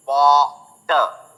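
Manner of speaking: "बॉटर" slow, split into baw and tuh; slow syllable-by-syllable "बॉटर"; rounded aw in Botter. Shown as a demonstration. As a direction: stretch the aw in "बॉटर". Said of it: "वोटर नहीं है".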